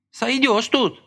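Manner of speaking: high, grim muttered retort; through gritted teeth. A narrator's reading. Masha answers Lika grimly, through gritted teeth. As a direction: spit it out grimly. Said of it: "процедила сквозь зубы Маша".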